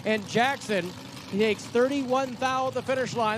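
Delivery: high, pushing play-by-play over the finish; excited race call. Acted. Announcer calling a ninety-year-old's winning run, excited. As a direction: call the run home like the crowd's on its feet.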